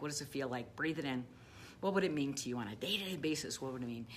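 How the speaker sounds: soft guided visualization voice; quiet, guiding coach voice. Acted. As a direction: lead the listener inward, softly.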